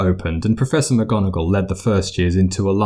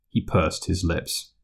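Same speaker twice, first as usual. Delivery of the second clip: ordinary delivery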